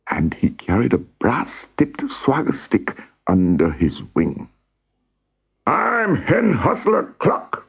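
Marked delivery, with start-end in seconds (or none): 5.6-7.6 high, slow, self-important crow; crowing cockerel. A narrator's reading. New head cockerel introduces himself to the hens, crowing. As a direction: announce yourself like a strutting cockerel, high-pitched. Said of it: "he crowed very loudly".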